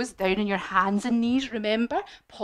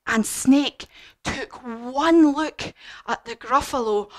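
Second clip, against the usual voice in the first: ordinary delivery, nothing marked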